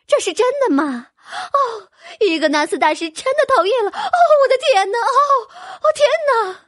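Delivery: high, loud gushing joy rising to squeals; delighted cry. Acted. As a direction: squeal with delight like a thrilled noblewoman. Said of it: "惊喜地叫起来". (惊喜若狂的高声尖叫)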